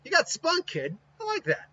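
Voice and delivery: grudging approval, a little mocking; amused tough girl. Taiyo sizing up Naruto, amused and approving. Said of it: "she actually kinda goes".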